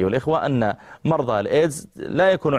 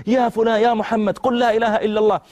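calling out; 11 semitones higher than usual. Retold or imitated. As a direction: call out to him as he slips away. Calling out to dying youth.

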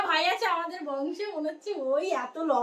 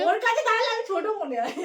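laughing; 6 semitones higher than usual. Real, not acted. Laughing beside the tall boy.

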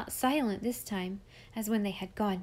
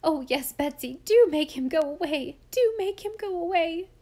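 loud, high pleading, climbing higher; frightened cry; 7 semitones higher, 7 dB louder than usual. A narrator's reading. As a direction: beg anxiously, rising into a wail. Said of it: "Aunt Frances cried out".

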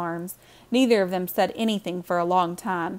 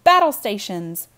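loud, drawn-out call to action; rallying the household; shouted command; 7 dB louder than usual. A narrator's reading. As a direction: call it out like an order to the troops. Mother shouts orders as rain pours in.

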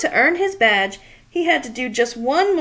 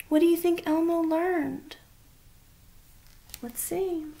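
higher, much softer question; soft aside to child; 4 semitones higher, 6 dB quieter than usual. Acted. Asks child listener softly.

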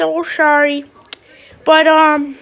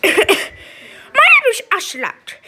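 slow, higher put-on puppet voice, with a cough and a laugh; 4 semitones higher, slower than usual. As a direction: introduce yourself slowly as the puppet Ocelot. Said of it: "Sorry about that voice".

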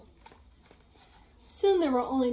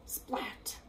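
gasp and splash; 11 dB quieter than usual. Acted. Splat jumps into water.

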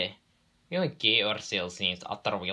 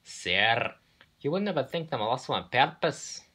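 higher, hurt Scottish protest; pained; 7 semitones higher, 4 dB louder than usual. A narrator's reading. Twins accused of losing numbers on purpose, pained. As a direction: plead innocence with wounded surprise. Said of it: "The twins looked pained".

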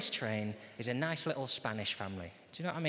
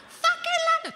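put-on very high, loud kid squeal; excited Spanish child; 7 dB louder than usual. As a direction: shriek it like an overexcited little boy. Spanish child cheers and swears.

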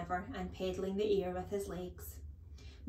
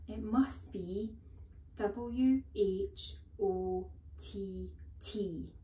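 slow, careful, hesitant spelling; boy spells very slowly to avoid a beating; much slower than usual. Acted. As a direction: spell it out as if stepping on ice. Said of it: "then he said very slowly".

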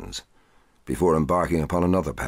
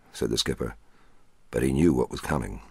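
ordinary delivery, nothing marked

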